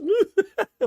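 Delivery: quick high burst of laughter; short laugh. Real, not acted. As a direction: let a short laugh slip out. Laughs at his own joke.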